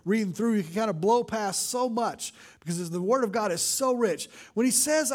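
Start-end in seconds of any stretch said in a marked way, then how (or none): none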